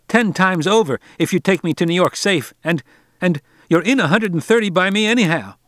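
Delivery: put-on a boy trying to buy his way home; angry spoiled boy. A narrator's reading. Harvey keeps bargaining angrily.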